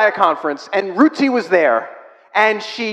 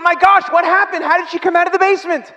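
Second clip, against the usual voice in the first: amazed question; 10 semitones higher, 4 dB louder than usual. Retold or imitated. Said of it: "Susie went".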